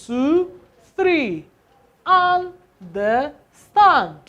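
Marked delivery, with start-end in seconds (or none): 2.1-4.2 Understand drawn out very slowly, syllable by syllable; stress on Understand. Shown as a demonstration. Teacher drilling the class on word stress, slow and deliberate. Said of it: "You stress this".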